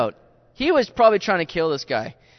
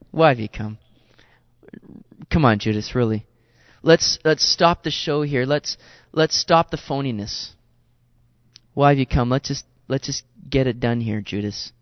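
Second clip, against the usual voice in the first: lower, firm but calm confrontation; lightly voiced Jesus; 6 semitones lower than usual. Jesus stopping the show with Judas, low and firm.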